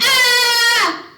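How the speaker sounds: sudden high loud scream of fright; startled scream. Acted. Driver finding the back seat empty, startled scream. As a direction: shriek as if the car is empty. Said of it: "He screamed".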